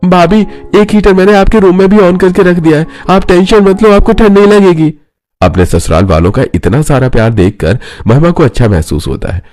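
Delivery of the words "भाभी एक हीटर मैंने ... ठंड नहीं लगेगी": high, eager character voice; obliging younger in-law, high and even; helpful brother-in-law. Acted. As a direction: reassure her in a pitched-up voice.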